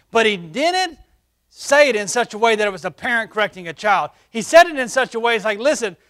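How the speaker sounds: high, loud preacher's cry; full-force preaching. Acted. Pastor preaching to the church, ringing out.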